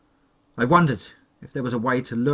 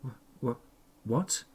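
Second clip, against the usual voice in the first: shocked stammer; 8 dB quieter, much slower than usual. A narrator's reading. Stunned victim stammers.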